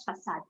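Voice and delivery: facade as "fa-sad"; soft S sound. Shown as a demonstration. Teacher restating the correct form, facade with soft S. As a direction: say facade with an S in the middle. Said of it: "helps you to pronounce it as".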